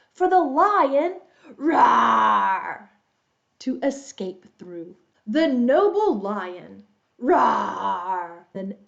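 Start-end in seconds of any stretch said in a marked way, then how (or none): 1.6-2.9 loud, long play roar; lion roar. Pretend. Storyteller plays the lion escaping the net, loud roar. 7.2-8.5 drawn-out play lion roar. Pretend. Storyteller plays the lion for young listeners, drawn-out roar.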